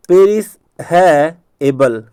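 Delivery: "पेरिस है एबल" slowly as three words; broken into parts. Shown as a demonstration. Teacher introducing a memory trick, broken into parts. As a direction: stretch "पेरिस है एबल" with clear gaps between parts. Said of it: "याद करवाने के लिए मैं कह रहा हूँ ऐसा".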